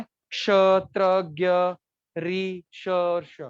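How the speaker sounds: each letter said slowly, one by one; slow demo letters. Shown as a demonstration. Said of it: "तब हम देखेंगे संयुक्त अक्षर". (धीरे-धीरे अक्षर बोलना)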